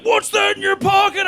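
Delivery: high-pitched barked cop question; mock cop shout. Cop spotting the jar in his pocket, high accusing shout.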